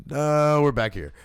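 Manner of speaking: sudden loud reality check. Retold or imitated. Snapping himself back to reality.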